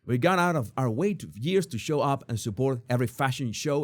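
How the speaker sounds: normal talking voice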